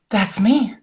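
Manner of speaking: sharp gasp then realizing; gasp of recognition. Retold or imitated. Wife realizing she is the one described, gasping. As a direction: catch your breath as it hits home.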